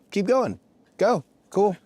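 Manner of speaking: imitated boss. Boss catching an employee mid-joke, imitated high urging. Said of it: "And she just goes".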